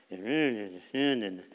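ordinary delivery

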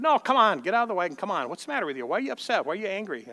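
urgent coaxing. Retold or imitated. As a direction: press him to come out, half pleading. Coaxing upset boy from wagon.

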